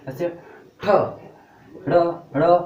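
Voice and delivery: ड़ said twice, loud and hard; retroflex sounds demo. Shown as a demonstration. Teacher to students, voicing ठ ड़ ड़ loudly. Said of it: "ये आपका लग रहा है कि नहीं".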